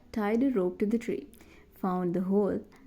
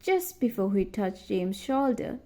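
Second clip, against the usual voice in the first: plain reading voice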